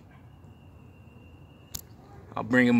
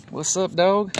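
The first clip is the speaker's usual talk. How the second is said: higher and louder, happy to see him; excited greeting; 8 semitones higher, 5 dB louder, slower than usual. Real, not acted. Greets his pet bird excitedly.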